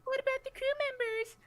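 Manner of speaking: very high, quick squeaky voice; squeaky mock protest. Crewman pleading about the crew members, squeaky mock protest. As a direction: squeak it out in a tiny panicked voice.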